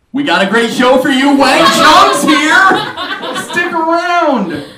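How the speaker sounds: loud, high show host hype. Pretend. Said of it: "hosted Saturday Night Live".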